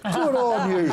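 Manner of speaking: high, bright cheer; cheery high praise. Real, not acted. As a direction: pitch it up high in delight. Cheering the letter writer.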